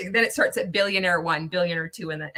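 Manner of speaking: normal talking voice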